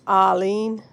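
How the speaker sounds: Boston accent attempt, saying "Arlene" as "Ahleen". Shown as a demonstration. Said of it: "I can't even get the Boston accent down".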